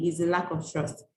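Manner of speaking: ordinary talk, nothing marked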